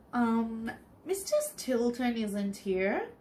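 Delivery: plain reading voice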